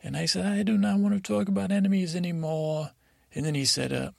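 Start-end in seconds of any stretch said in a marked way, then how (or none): 0.5-2.9 high, quick insistent refusal; firm protest. Acted. Tom refuses more talk of enemies, high hurried protest. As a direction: put your foot down, pitched up and rushed.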